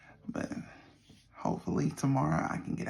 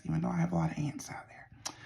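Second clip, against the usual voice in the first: whispering; 6 dB quieter, faster than usual. Real, not acted. Whispered aside about ants.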